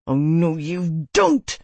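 slow angry words spat through teeth; through gritted teeth. A narrator's reading. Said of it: "he spit through his teeth".

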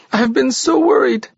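overwhelmed and frantic; frightened. A narrator's reading. Ona to Jorgis after a night of terror, frightened and pleading. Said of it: "clinging to him wildly".

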